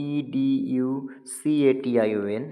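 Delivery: slow, evenly spaced letter names; spelled out letter by letter. Shown as a demonstration. Teacher spelling EDUCATION for students to count, letter by letter. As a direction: spell it slowly, one letter at a time.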